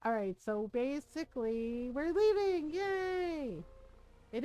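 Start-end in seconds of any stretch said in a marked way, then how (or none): 1.9-3.5 high-pitched little cheer; light, high yay of celebration; celebratory cheer. Real, not acted. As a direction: sing out a happy departure. Celebrating departure on the ship.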